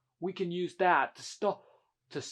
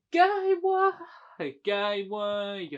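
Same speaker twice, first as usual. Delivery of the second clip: high, loud pretend wail; wailed protest; 4 semitones higher, 8 dB louder, much slower than usual. Character interrupting the argument, high loud wail.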